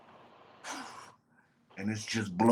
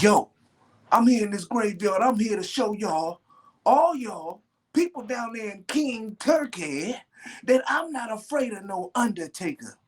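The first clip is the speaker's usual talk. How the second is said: high-pitched wrestling promo bravado; New Jack; 10 semitones higher than usual. Retold or imitated. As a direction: cut a defiant promo in a high voice. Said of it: "And then New Jack's like".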